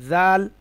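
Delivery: জাল said light and loud; a thin buzzing জাল; light, clear জাল; thin dhal. Shown as a demonstration. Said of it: "পাতলা আওয়াজ".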